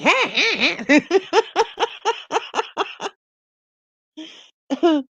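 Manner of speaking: long, high cackle; crazy laugh. Shown as a demonstration. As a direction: let a big crazy laugh run on. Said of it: "I think I got a crazy laugh".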